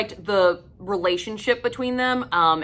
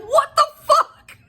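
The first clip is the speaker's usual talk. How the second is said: very high, loud, slow shrieking disbelief; shocked squeal; 7 dB louder, slower than usual. Retold or imitated. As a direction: squeal it like you can't believe it. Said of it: "I'm like".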